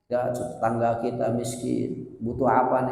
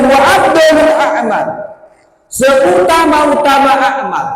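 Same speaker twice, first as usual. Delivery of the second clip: higher, louder fiery sermon voice; full-force preaching; 8 semitones higher, 10 dB louder than usual. Acted. Preacher rouses the congregation about helping others, full force. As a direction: proclaim it like a rousing preacher.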